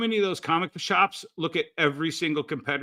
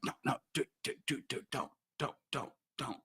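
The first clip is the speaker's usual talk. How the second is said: much softer, breathy whispered warning; urgent whisper; 2 semitones lower, 12 dB quieter than usual. Retold or imitated. Brian hushing his friend at the bar, an urgent whisper. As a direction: hiss it to stop your friend calling out.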